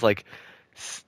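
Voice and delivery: normal talking voice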